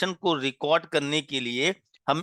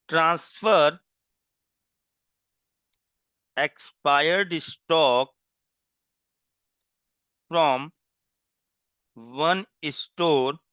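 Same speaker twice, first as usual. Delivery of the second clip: slow dictation; much slower than usual. Shown as a demonstration. Teacher dictating a heading for students to copy, slow and deliberate. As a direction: dictate it word by word so students can write.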